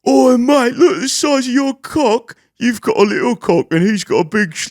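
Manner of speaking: high, loud laddish jeering; mocking loud London lads. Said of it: "they were just like".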